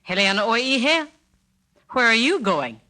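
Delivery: loud, high, angry questioning; irate mother. Shown as a demonstration. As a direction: demand it like an irate mother catching a teenager sneaking out. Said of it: "If you are an irate mother".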